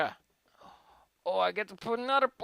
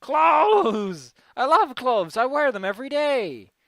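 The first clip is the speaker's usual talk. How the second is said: hammed-up excitement; 5 semitones higher, 8 dB louder, slower than usual. Excited silly exclamation.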